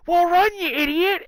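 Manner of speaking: high, loud yell to run; dad's yell. Retold or imitated. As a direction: yell at the kid to get moving. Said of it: "the dad yell".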